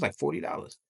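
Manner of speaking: ordinary delivery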